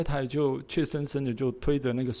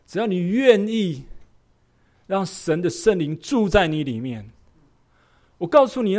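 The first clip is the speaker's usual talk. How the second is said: slow, rising, louder preaching; preaching appeal; 7 semitones higher, 7 dB louder than usual. Acted. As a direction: build into a fervent altar call. (激昂地讲道呼召)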